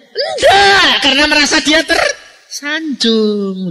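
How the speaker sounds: loud, high exclaimed answer; emphatic shout. Acted. Preacher answers his own question to the church, loud high shout. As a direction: shout the answer out, then slow down.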